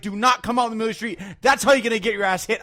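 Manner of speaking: reenacted heated lecture; retold angry scolding. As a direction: rattle off a furious warning to a driver. Retold argument with a driver.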